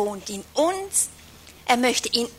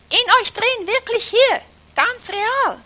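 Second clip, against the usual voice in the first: excited; 10 semitones higher, 4 dB louder than usual. Real, not acted. Excited preaching about indwelling Christ.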